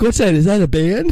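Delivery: high, puzzled little voice; mock clueless. Tobey asking about the Avengers, mock puzzled high voice. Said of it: "He's like".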